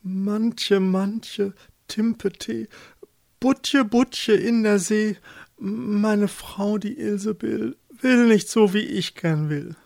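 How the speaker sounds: fearful high, drawn-out chant; anxious summons. A narrator's reading. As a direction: call out the rhyme in dread, pitched high. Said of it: "stand da in seiner Angst und sagte". (ängstlich gerufener Beschwörungsreim, hohe Stimme)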